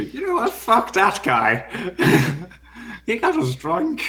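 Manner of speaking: put-on high, loud drunken outburst; indignant drunk kobold. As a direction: huff it out like a wronged drunk. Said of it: "they should just go".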